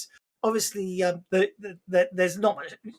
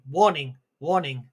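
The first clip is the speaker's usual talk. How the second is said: automated alarm announcement, slightly lower; 4 semitones lower than usual. Acted. Game master voices the ship's alarm, automated voice. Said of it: "like, a automated voice going".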